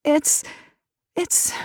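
snuffling. A narrator's reading. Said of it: "Katie snuffled into her sleeve".

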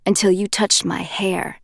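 usual speaking voice

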